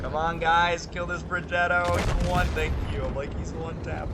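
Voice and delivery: loud, high plea to teammates; urgent calling out. Real, not acted. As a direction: urge the team on, exasperated. Urging teammates to finish a kill.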